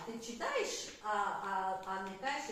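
ordinary delivery

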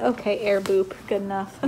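normal talking voice

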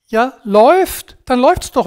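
loud, high-pitched sarcastic cheer; mock approval. Coach mockingly applauds a stressed listener's self-destruction, loud sarcastic approval.

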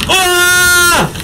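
높고 길게 끄는 우와; 놀라서 길게 소리치기. Real, not acted. (길게 끄는 환호성)

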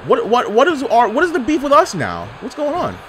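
high, baffled protest; incredulous. Real, not acted. Gamer suddenly ganged up on, high baffled protest. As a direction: pitch up in confused outrage. Everyone turns on him.

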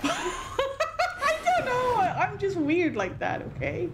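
high, slow giggling admission; embarrassed laugh. Real, not acted. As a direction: laugh it off, drawn out. Embarrassed at own joke.

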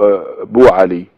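slow emphatic بو علي; Persian form, no hamza. Shown as a demonstration. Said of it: "أبو علي يقول لك بو". (نطق بو علي بدون همزة ببطء)

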